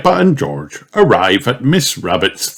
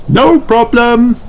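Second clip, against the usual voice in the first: Miss Rabbit; 5 dB louder than usual. Acted. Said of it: "replies Miss Rabbit".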